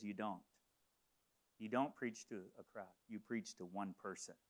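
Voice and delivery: low, soft, measured retold reply; quiet divine answer. Voices the Lord's gentle answer.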